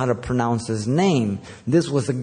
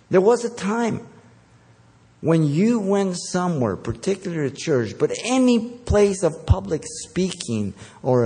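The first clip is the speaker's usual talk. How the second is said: high-pitched, emphatic sermon rebuke; indignant preaching; 7 semitones higher than usual. Real, not acted. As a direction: drive the reproach home with raised pitch and weighty pauses. Preaches indignantly at lost respect in church.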